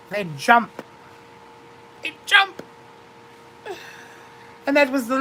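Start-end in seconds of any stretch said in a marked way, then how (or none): 2.0-2.6 higher, rising. Acted. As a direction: toss it up like the horse leaping. Repeated line, two deliveries.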